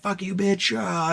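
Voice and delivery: high but held-back imitation yell; softened retold screaming; mock yelling. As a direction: mimic an irate customer without the volume. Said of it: "yelling at her and screaming".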